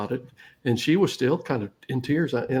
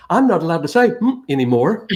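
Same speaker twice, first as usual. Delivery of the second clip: put-on louder, higher toddler announcement; pitched-up kid voice, loud and earnest; little girl; 4 semitones higher, 6 dB louder than usual. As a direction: imitate a small child proudly reporting a rule. Toddler daughter confiding, acted by dad.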